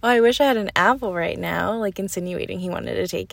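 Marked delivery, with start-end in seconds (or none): 0.0-1.8 louder, mock-hinting imitation of a coworker; lightly snide, pointed wish. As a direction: imitate a coworker angling for a snack. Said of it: "like, a little snide comment".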